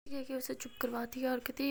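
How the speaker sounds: ordinary delivery, nothing marked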